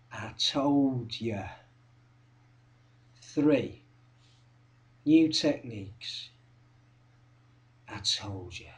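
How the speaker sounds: low, soft, with long pauses; hushed, knowing reminder; quiet recap. Acted. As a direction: count it off under the breath.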